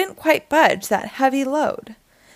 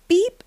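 high little beep; short rising toot; truck horn beep; 8 semitones higher than usual. A narrator's reading. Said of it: "said Blue".

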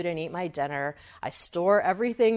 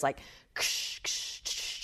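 unvoiced rustling ksh sounds, softer; rustling noise imitation; 5 semitones lower, 7 dB quieter, slower than usual. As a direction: mimic something creeping through the underbrush. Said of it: "all I hear is".

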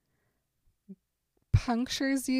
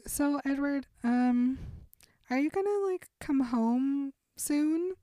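much higher, slowed, tentative dad asking; hesitant dad; 5 semitones higher than usual. Acted. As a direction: coax him home gently. Father timidly asking son home.

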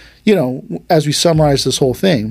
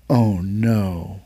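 low, soft sinking "oh no"; retold dismay; 5 semitones lower, 6 dB quieter than usual. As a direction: quietly dread what is coming. Sees huge opponent he must fire.

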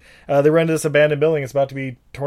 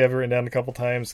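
usual speaking voice

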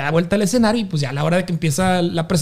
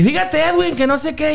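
mock stage banter; 6 semitones higher than usual.

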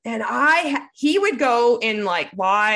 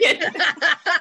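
a high, short burst of laughter; real laugh; 6 semitones higher than usual. Real, not acted. Ranting about fake bro bosses to her cohost, laughing high. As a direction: laugh at your own rant.

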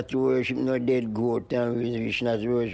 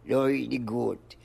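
normal talking voice

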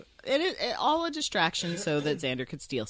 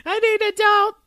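panicked cry; 11 dB louder than usual. Pretend. Mock panic at a cosplayer.

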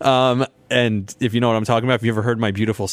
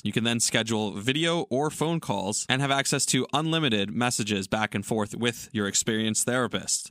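softer, brisk sponsor read; steady, softer podcast ad read; 8 dB quieter than usual. Acted. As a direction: read the ad like a smooth host spot. Host reads the sponsor ad.

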